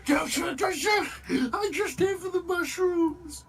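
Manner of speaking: high, loud crying yell; panicked surrender shout. Acted. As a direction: beg for your life at full voice. Mock surrender, same plea.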